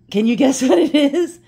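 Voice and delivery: laughing through a teasing question. Real, not acted. To viewers, teasing about the box, laughing. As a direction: giggle while you tease them.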